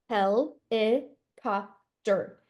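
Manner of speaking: "helicopter" said very slowly as hel-i-cop-ter; chopped into syllables. Shown as a demonstration. Teacher tapping syllables on the body, slow "helicopter". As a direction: tap four beats on "helicopter". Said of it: "tapping the syllables".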